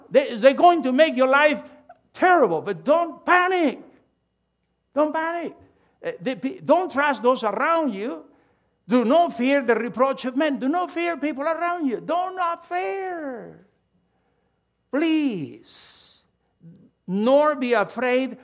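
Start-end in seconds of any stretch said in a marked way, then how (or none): 0.1-12.0 high, loud preacher's urgent appeal; impassioned preaching. Acted. Preaching builds to a peak. 12.0-16.2 tender plea. Acted. Pleading climax of sermon.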